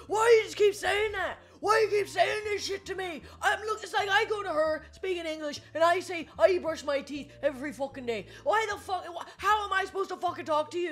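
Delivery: put-on high-pitched drunken outrage without full volume; mock angry screaming. Imitating drunk man fed up with Tom's phrases, high mock scream. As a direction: imitate an exasperated drunk, voice pushed way up. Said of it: "He starts screaming".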